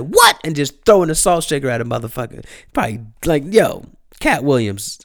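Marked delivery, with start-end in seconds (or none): 0.0-0.4 a sudden, much higher mock-angry "What?". Imitating angry Cat at the server, high mock outrage. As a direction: jump up high like an offended Cat. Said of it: "Cat being angry and being like".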